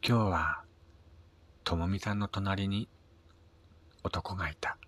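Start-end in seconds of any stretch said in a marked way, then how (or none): none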